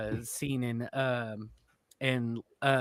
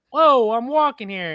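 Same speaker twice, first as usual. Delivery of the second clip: loud, high, drawn-out mock New York accent; New York accent cliche; 10 semitones higher, 12 dB louder than usual. Imitating a tourist after she bumps into him, loud New York shout. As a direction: holler it like a cliche New Yorker. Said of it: "doing the cliches".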